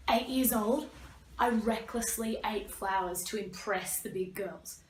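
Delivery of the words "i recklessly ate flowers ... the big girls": normal talking voice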